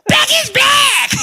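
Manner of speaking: slow, screeched high and loud; same hammed-up rock screech. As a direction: rasp out a stadium rock yell. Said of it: "my [name] impression".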